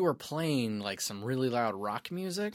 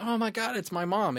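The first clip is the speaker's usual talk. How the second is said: high-pitched imitation of a shocked reaction; mock astonishment; 10 semitones higher than usual. Imitating someone meeting a ghost's mom, mock astonishment. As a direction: act amazed, pitch jumping way up.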